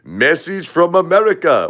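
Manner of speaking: high Jamaican call-out; Jamaican accent. Retold or imitated. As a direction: announce the news in a Jamaican accent. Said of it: "Maurice says".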